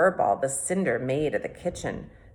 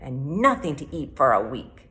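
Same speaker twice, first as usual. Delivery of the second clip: growled threat; 3 semitones lower than usual. A narrator's reading. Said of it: "he growled at Furball".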